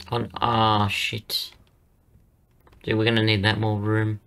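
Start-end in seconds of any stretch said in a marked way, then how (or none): none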